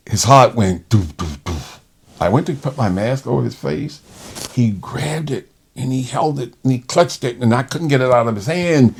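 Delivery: ordinary talk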